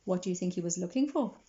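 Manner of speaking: plain reading voice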